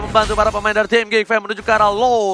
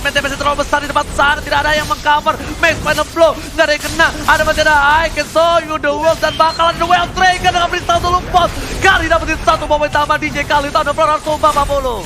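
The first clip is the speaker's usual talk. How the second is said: hyped, rapid play-by-play; fighting game announcer; 6 semitones higher, 4 dB louder than usual. Real, not acted. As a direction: yell the fight call higher and faster. Esports caster hyping a teamfight.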